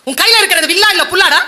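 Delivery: mocking taunt. Dharma's insulting taunt.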